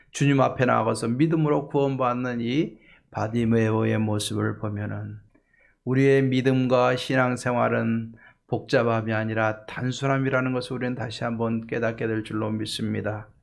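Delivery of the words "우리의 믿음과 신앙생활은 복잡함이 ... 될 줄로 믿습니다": ordinary talk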